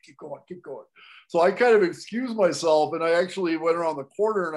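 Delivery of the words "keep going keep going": hushed, much softer aside; covert aside. Retold or imitated. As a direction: signal the crew under your breath. Signalling photographers to keep filming.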